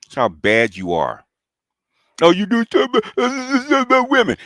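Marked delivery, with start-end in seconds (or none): 2.2-4.4 much higher, louder mock whine of the rival. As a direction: squeak it out to ridicule him. Mocking rival's high voice.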